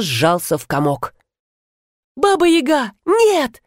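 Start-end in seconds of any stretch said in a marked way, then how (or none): 2.1-3.6 high-pitched panicked cry; terrified refusal. A narrator's reading. Youth shrinking from Baba Yaga, high frightened cry. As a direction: recoil in fear, pitch leaping up.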